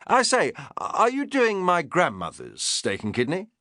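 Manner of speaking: a hint of an eager upper-class lord; English lord. Lord Errington asking his chef about foraging, lightly posh.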